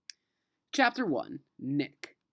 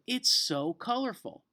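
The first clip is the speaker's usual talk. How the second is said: higher, bright delighted praise; teacher clapping, praising the class, delighted; 8 semitones higher than usual. Acted. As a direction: praise it like a thrilled new teacher. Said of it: "clapped her hands and said".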